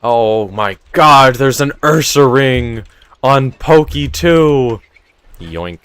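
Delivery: ordinary delivery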